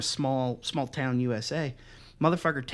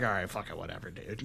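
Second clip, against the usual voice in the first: dismissive; 8 semitones lower, 10 dB quieter than usual. Retold or imitated. Unimpressed reply to the wrestlers' hype.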